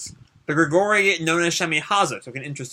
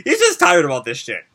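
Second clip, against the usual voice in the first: ordinary delivery, nothing marked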